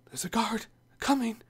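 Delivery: hushed warning whisper; urgent whisper. Acted. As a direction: whisper the warning so the guard won't hear. Warning of approaching guard.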